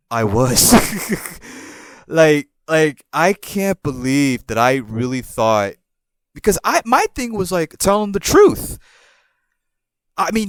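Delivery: ordinary delivery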